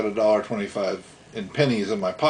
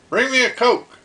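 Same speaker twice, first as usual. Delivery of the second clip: dad ordering; 12 semitones higher, 4 dB louder than usual. Retold or imitated. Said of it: "He goes".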